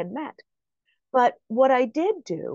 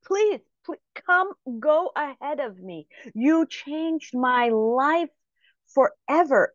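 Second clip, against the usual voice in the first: higher, imitated effusive pleading; gushing gratitude; 5 semitones higher than usual. Grateful stranger urging the speaker ahead in line, imitated gushing. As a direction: gush like an overwhelmed fan, stretching the praise.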